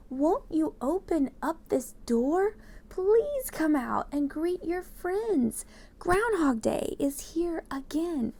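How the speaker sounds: high, slow coaxing plea; mayor begging the groundhog; pleading mayor. Acted. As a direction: sweet-talk him out.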